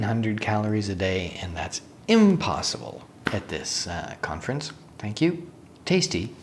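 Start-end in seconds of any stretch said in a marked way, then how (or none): none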